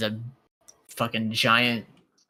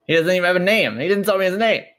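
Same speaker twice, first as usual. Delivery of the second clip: higher, louder protest; indignant; 6 semitones higher, 6 dB louder, faster than usual. Acted. Player protesting they don't know this guy, indignant. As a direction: protest it, raised and incredulous.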